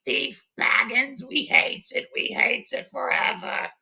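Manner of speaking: high, slow whispered creature scream; Gollum screaming. Acted. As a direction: spit it after the thief like a hateful creature. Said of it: "Gollum simply screams after Bilbo".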